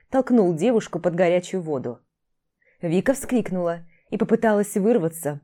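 usual speaking voice

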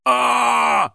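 loud, high sustained yell; acted cry of pain; pained scream. A narrator's reading. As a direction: scream as if stung in the eyes. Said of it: "закричал он".

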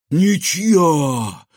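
loud, high exhaled cry; breathless cry. A narrator's reading. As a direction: gasp it out after the fall. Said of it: "выдохнула Светка".

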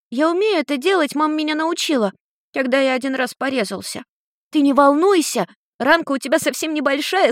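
put-on high, worried young boy; a kind boy fretting over a cat; little boy voice. A narrator's reading.

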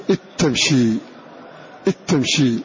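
إذ تمشي said as "itamshi", the ذ gone into the ت; dh merged into t. Shown as a demonstration. Teacher showing students the full merge: إذ تمشي as "itamshi". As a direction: run إذ straight into تمشي with a doubled t. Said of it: "فتنطق بالتاء فقط".

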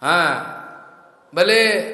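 usual speaking voice

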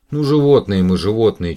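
loud, exasperated almost-crying shout; near-tears shout. A narrator's reading. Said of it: "чуть не плача закричала Маша".

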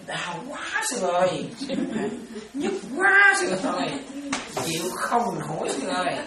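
hammed-up wail of a suffering devotee; mock wailing devotee. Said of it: "La hết sức là la".

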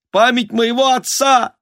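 very high, thin desperate begging; pleading squeak. A narrator's reading. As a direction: squeak your plea over the din. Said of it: "прорывался писк Анны Васильевны". (умоляющий писк)

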